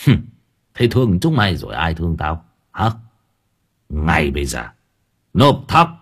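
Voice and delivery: lightly voiced scornful landlord's son; a hint of a cold, harsh master demanding rice; landlord's son snapping at the begging woman, lightly voiced and cold; gruff young master. A narrator's reading. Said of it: "cau mày gằn giọng".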